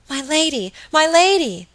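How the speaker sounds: high urgent call, rising in force; alarmed cry. A narrator's reading. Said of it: "cried Phoebe".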